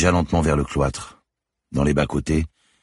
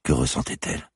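usual speaking voice

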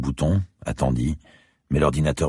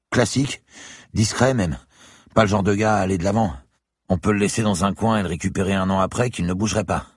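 put-on higher, young student's voice; 7 semitones higher than usual. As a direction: voice the eager student. Student character voice. (voix d'étudiant plus aiguë)

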